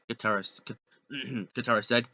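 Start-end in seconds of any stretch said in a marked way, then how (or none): none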